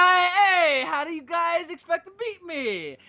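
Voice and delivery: high, loud taunting ninja turtle voice; hammed-up shouted cartoon taunt; mock Raphael shout. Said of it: "where he's like".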